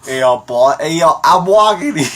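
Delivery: loud, high parody Boston voice; badly faked Boston street accent; hammed-up Boston accent. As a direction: shout like a caricature Bostonian, near laughter. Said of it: "Boston is like".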